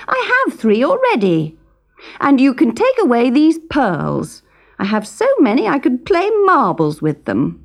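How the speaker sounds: high, petulant spoilt princess; sulky royal brat; cartoon princess. A narrator's reading. Princess voice continues.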